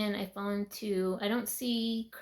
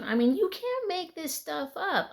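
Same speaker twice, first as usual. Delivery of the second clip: high, loud disbelief; can't believe what just arrived; amazed; 8 semitones higher, 6 dB louder than usual. Real, not acted. Telling viewers about the statue, amazed and loud.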